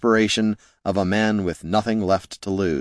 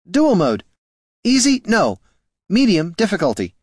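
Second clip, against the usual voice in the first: raised, clipped orders; commanding; 10 semitones higher, 3 dB louder than usual. Acted. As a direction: bark commands at the machine. Wizard commands golem.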